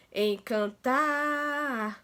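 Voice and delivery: "encantar" with the final "tar" dragged out very long; stretched stressed syllable. Shown as a demonstration. Teacher showing pupils how to twist the word, "encantar" with "tar" drawn out. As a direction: hold the last vowel of "encantar" in a long, steady stretch. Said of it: "a sílaba que se arrastou mais".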